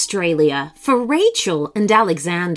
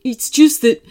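kangaroo voice; 3 semitones higher, 4 dB louder than usual. Acted. Kangaroo character voice.

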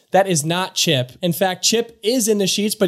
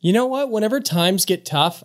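ordinary talk

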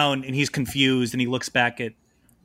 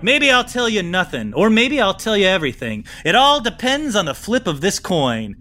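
raised, playful swagger; higher, teasing charmer; smooth high-status schemer; 5 semitones higher, 3 dB louder than usual. Acted. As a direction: toy with them like a cool con man. NPC Snake Professional speaking.

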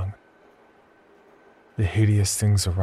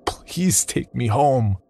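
slow, higher desperate plea; begging; 5 semitones higher, much slower than usual. A narrator's reading. Narrator begging the stranger to take him home, slow and desperate. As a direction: draw it out, pleading. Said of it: "I begged".

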